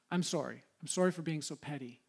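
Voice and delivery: low, soft, retold apology; sheepish confession. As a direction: own up quietly, acting out the apology. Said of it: "I confess it to the desk".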